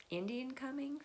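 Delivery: high, surprised question; puzzled echo. Retold or imitated. Pilgrims confused by the warning, high puzzled echo. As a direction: repeat it back in disbelief. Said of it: "and they're like".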